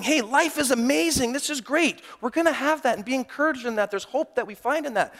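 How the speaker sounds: high, bright upbeat claim; chirpy, lightly mocking cheer; mock cheerful note from a church bucket; cheerful testimony. Voicing cheerful note writers.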